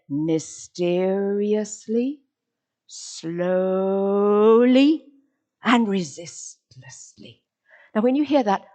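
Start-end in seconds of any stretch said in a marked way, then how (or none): 0.1-7.4 "mysteriously", "slowly" drawn out with rising ends, "resistlessly" falling; slowly, each separate. Shown as a demonstration. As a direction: stretch "slowly" long and pause between adverbs. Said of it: "You have to say".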